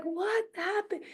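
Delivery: high, hushed disbelief, acted; mock bewilderment. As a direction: react in small, stunned mock dismay. Murari reacts to his fouled plate.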